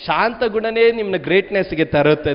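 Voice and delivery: usual speaking voice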